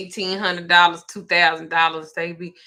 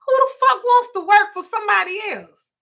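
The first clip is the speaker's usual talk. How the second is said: incredulous scoff; 13 semitones higher, 4 dB louder than usual. Real, not acted. Scoffing at working a job.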